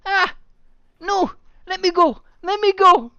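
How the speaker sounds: high loud panicked yelling; struggling against restraints. Acted. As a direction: yell while pulling free. Character grabbed by root.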